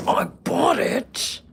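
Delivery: loud, high, stretched-out retort; hammed-up shouted comeback; mock yell. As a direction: holler it drawn out, playfully outraged. Acted ball dispute, loud retort.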